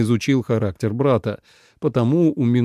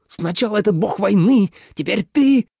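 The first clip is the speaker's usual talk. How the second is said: high, raised-voice accusation; enraged growl; 10 semitones higher, 4 dB louder than usual. A narrator's reading. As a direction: rage at a betrayer. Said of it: "прорычал Минлу". (яростно, срываясь вверх)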